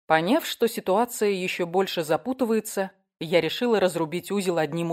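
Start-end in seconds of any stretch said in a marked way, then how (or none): none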